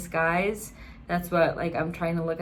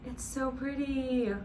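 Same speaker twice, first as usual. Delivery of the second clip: awed; 3 semitones higher, 6 dB quieter than usual. Real, not acted. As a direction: marvel at it, breathless. Awed by the camera.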